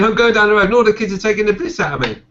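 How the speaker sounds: high, loud Essex lad voice; essex accent. Retold or imitated. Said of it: "And he's saying".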